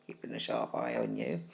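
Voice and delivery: mock-suspicious deep murmur; suspicious growl. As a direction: narrow your eyes and warn them in a deep low voice. Suspicious friend voice.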